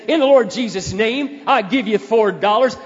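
loud, preachy mock declaration; mock pious proclamation. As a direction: ham up a tacky holy-roller announcement. Mocking tacky pious declaration.

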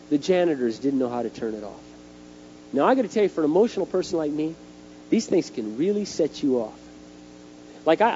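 usual speaking voice

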